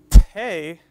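"te" drawn out with a strong puff of air; loud demo "te", heavily aspirated. Shown as a demonstration. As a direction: release the t of "te" in a long burst of breath. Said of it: "is an aspirated sound".

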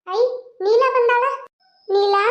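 high, slow new voice calling out; a different neighbour woman announcing Neela; another woman calling. Acted. Neighbour woman announces Neela has come, high calling voice. New character arrives, distinct voice.

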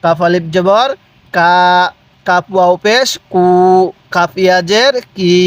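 lilting letter names, then "কা" held level; measured spelling chant with long "কা"; drawn-out vowels. Shown as a demonstration. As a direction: spell it out and lengthen "কু" and "কি". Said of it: "উচ্চারণ কিভাবে পড়বেন".

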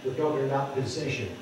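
ordinary talk, nothing marked

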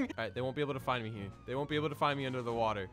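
low, soft and fast; hushed. Pretend. Hiding from the cops, soft fast mutter. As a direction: mutter it to yourself.

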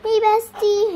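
put-on high, loud girly hello; excited pretend greeting. One doll greets her bestie, excited and high. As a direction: call out playfully to a best friend.

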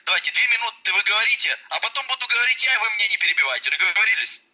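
ordinary talk

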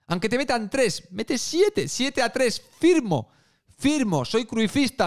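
high, eager fan outburst; excited. Real, not acted. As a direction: shout it like a hyped fan.

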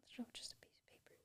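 very soft, voiceless whisper; whispered aside. Real, not acted. Writer marvels at writing on a journal, whispered aside. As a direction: whisper it under your breath. Murmuring to self while writing.

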